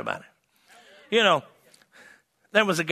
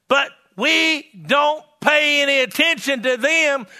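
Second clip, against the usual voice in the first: emphatic, declamatory revival sermon; fervent preaching; 9 semitones higher, 6 dB louder, slower than usual. Real, not acted. Evangelist urging faith in God's word, fervent preaching. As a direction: build to a ringing hallelujah.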